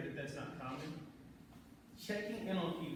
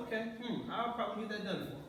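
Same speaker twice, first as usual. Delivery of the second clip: higher-pitched mulling it over, then agreeing; imitated agreeable employee; 6 semitones higher than usual. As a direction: voice a willing listener's thought. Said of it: "where he's like".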